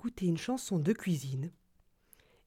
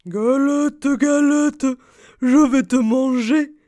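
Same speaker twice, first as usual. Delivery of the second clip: loud growling bear; gruff bear voice; 5 semitones higher, 12 dB louder, slower than usual. Acted. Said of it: "grogne l'ours de sa grosse voix".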